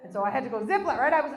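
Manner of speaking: normal talking voice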